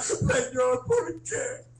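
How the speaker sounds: high plaintive whine to heaven; mock pleading prayer. Kneeling believer asking God why, mock pleading. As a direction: plead it high, as a hammed-up complaint. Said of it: "Y al final decimos, nos arrodillamos a orar".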